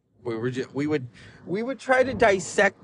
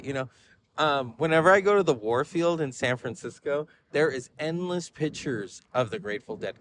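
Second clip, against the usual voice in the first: ordinary talk, nothing marked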